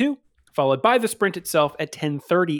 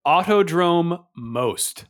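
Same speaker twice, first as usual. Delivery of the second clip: slow drawn-out; 3 semitones higher, much slower than usual. Shown as a demonstration. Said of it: "savoring a funny circuit name".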